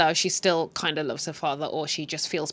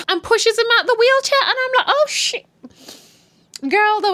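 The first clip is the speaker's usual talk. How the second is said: high, loud shocked exclaim; shocked outburst; 7 dB louder than usual. Real, not acted. Vlogger gasping at the show's shocking push, high and loud. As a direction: react like you can't believe it.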